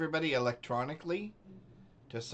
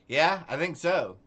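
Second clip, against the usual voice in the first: "Yeah, I think so" said louder and higher while still laughing; 5 semitones higher, 8 dB louder than usual. Real, not acted. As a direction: let the words ride on the laugh. Still laughing, then calms down.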